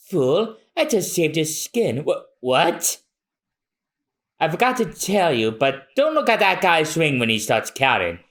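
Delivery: normal talking voice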